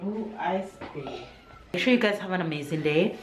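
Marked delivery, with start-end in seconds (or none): 0.0-1.2 slow and soft, drawing the words out; gently naming the colour for her son; slow teaching voice. Real, not acted. Mom teaching colours to toddler.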